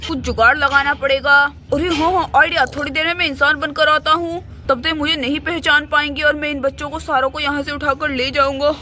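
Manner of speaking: low, scheming villain voice muttering his plan; low plotting voice; scheming beast's inner voice. Acted. Beast plotting to carry off the kids, low and scheming. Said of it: "ये जानवर अपने मन मन में सोचने लगता है".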